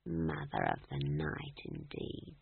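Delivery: unvoiced, low whisper, slow. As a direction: hiss the title back at him softly. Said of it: "she whispered".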